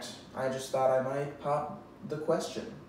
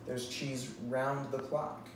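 ordinary talk, nothing marked

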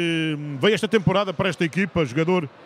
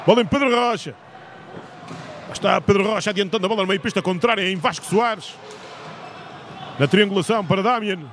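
live sports commentator calling action; play-by-play commentary. Real, not acted. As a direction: call the play as it happens.

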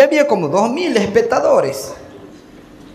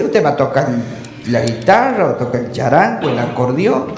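doctor voice; 9 semitones lower than usual. Acted. Doctor reassures patient.

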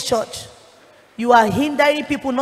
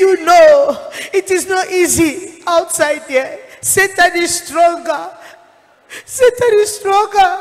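high, loud, slow lament; despairing lament; 5 semitones higher, 4 dB louder, slower than usual. Retold or imitated. Dead pastor lamenting to the preacher, high and slow. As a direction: mourn it as a hopeless soul. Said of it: "He said".

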